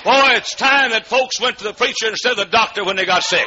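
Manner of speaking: put-on loud, high exhortation with a punch on Boy; preaching at full force. Preacher urging his congregation to trust God, loud and high. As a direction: drive the point home to the pews.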